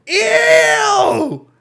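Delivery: loud, high, drawn-out mock shout of disgust; retold yell. Yelling at family from the car, high retold shout. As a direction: re-enact yelling it out of a car window. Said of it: "roll down the window and yell some shit".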